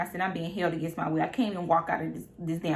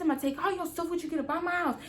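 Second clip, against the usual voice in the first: girlfriend's mock yell; 8 semitones higher, much faster than usual. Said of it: "She said".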